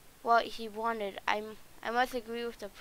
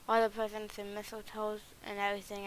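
ordinary talk

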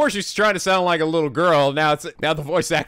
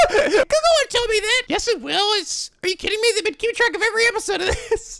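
put-on high girly voice. High character voice.

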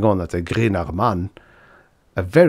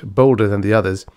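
ordinary delivery

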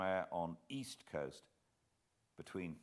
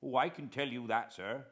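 put-on higher, louder respectful old sailor; lightly voiced naval veteran, eager to help; retired officer answering gentlemen politely; old coast guard man; 6 semitones higher, 6 dB louder than usual. A narrator's reading.

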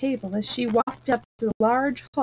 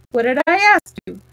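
impatient; 5 semitones higher, 7 dB louder than usual. A narrator's reading. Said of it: "Alice said impatiently".

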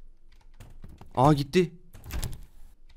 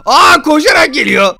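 high, loud, drawn-out cry of alarm; alarmed yell; 8 semitones higher, 8 dB louder, slower than usual. Real, not acted. Gamer seeing Baldi run at him, alarmed yell. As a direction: cry out as you spot the danger. Enemy suddenly runs at player.

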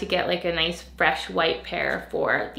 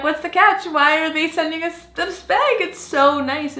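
higher, thrilled retelling; giddy disbelief; 9 semitones higher, 6 dB louder than usual. Retold or imitated. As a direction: gush like you can't believe it. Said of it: "I'm like".

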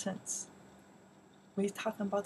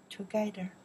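usual speaking voice

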